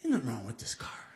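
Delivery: low, whispered, half laughing; self-reassuring whisper. Retold or imitated. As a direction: whisper it to yourself to stay calm. Whispering to himself driving home.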